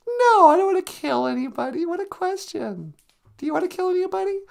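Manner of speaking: very high puppet-like voice, protesting; high-voiced character. Character asked who they'd like to kill, high protesting voice. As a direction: protest way up high, then turn the question back.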